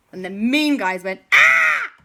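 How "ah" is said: short, very high, loud shriek; frightened scream. Acted. Mean guys ambushed from behind, frightened scream.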